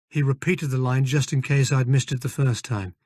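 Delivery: ordinary delivery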